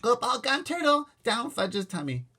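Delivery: put-on high, loud sing-song baby talk; toddler babble. Toddler babbling to his mother, gleeful. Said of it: "Fudge babbled".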